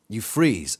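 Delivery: ordinary delivery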